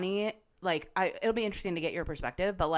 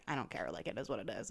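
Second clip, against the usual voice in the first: low, much softer, flat deadpan; indifferent shrug; 10 semitones lower, 13 dB quieter, faster than usual. Retold or imitated. Amanda brushing off the apology, low and indifferent. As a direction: toss it off like you couldn't care less.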